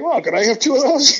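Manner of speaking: high, loud, laughing through the joke; laughing quip. Real, not acted. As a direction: crack the joke, giggling. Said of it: "laughing quip".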